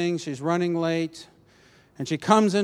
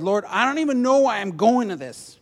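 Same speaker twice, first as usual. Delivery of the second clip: loud, higher-pitched complaint to God; exasperated plea; 6 semitones higher, 7 dB louder than usual. Retold or imitated. Driver complaining to the Lord about the meeting, exasperated. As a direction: push it out in exasperation.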